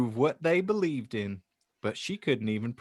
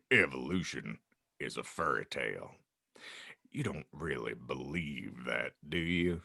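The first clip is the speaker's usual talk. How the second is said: much lower, soft giant voice; calm Hagrid; 14 semitones lower, 8 dB quieter than usual. Acted. Hagrid answering the aunt, low calm voice. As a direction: dismiss it gently and low.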